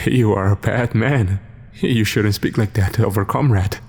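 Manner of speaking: usual speaking voice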